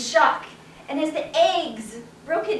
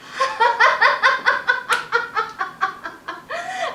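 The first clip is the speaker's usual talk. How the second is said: high laugh; 9 semitones higher than usual. Acted. Man at the back of the mob, bursting out laughing. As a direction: let the laugh break out. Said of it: "a man started to laugh".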